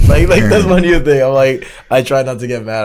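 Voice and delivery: bursts out laughing, loud and high. Real, not acted. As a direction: laugh through the words. Laughing while telling the joke.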